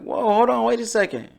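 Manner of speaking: high, startled hold on; mock offended surprise. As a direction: pretend to be taken aback. Acted offended reaction.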